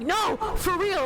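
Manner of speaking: high, stretched-out imitated insistence; freaking out. Retold guy insisting to doubters it's Batman, excited. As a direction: insist at a high pitch, drawing it out. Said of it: "he's, like, freaking out".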